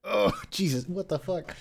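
strained voice after choking; coughing up water. Real, not acted. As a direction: push words out, still choking. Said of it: "that went down the wrong pipe".

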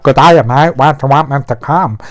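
lightly voiced Australian accent, quick on the question; a hint of Australian, tossed off fast. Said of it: "'cause he's from Australia".

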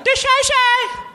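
high, slow shout. Pretend. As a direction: holler it out high. Catchphrase hollered again.

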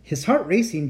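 ordinary delivery, nothing marked

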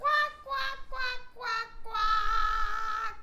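high singsong duck quacks. Pretend. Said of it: "you have to quack".

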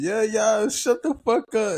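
high yelped mock rant; imitating tweaking man. Man yelling into train intercom, high mock rant. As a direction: pitch way up like the crazy guy. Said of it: "He pressing the button and".

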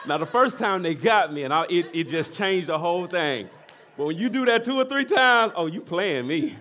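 loud, high, impassioned preacher; full-force preaching. Acted. Pastor to church, fervent shouted preaching. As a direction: preach it out to the congregation.

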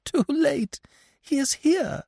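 very high wail of despair; acted sobbing panic; sobbed. A narrator's reading. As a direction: cry it out tearfully, pitched right up. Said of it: "she sobbed".

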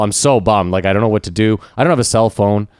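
usual speaking voice

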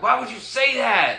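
high, loud exasperated plea; exasperated at the screen. Retold or imitated. Viewer pleading with a true-crime suspect, high and loud. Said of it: "I'm like".

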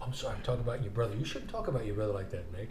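a quick hushed telling-off, like a coach; fast quiet scolding. Retold or imitated. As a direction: rattle off a soft, fast scolding.

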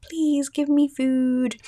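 high, slow pleading puppy voice; puppy begging voice. Retold or imitated. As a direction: beg for food like a whining puppy. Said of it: "she's like".

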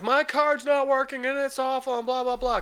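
singsong mocking of a complaint; complaining caller. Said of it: "when someone calls in".

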